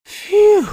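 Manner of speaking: high loud relieved sigh; relieved phew. Acted. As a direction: blow out with relief. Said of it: "said Ross".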